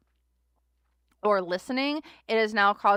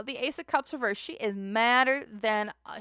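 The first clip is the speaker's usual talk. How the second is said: ordinary talk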